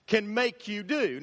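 ordinary talk

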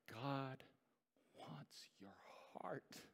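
slow, intense whisper; very soft, drawn-out appeal; hushed plea. Real, not acted. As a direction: whisper it straight to the listener's heart. Intimate climax of the appeal.

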